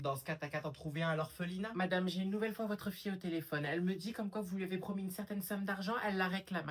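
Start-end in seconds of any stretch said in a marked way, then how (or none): none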